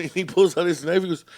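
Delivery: ordinary delivery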